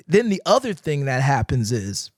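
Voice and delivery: ordinary delivery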